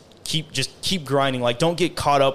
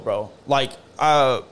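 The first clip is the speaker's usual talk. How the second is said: ordinary talk, nothing marked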